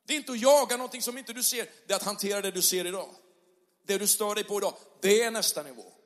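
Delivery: rousing pulpit climax; full-force preaching. Acted. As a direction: preach it to the whole room with fire. Preaching the main point.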